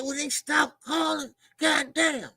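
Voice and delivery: high, slow exasperated yell; mock fed-up shout; retold frustrated outburst. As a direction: drag it out in annoyance. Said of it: "I was mad".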